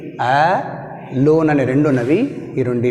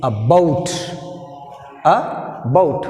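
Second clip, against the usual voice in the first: about with BOUT punched; light A, strong BOUT in "about"; second-syllable stress; much slower than usual. Shown as a demonstration. Teacher showing learners where stress falls, "about" stressed on BOUT. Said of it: "second syllable".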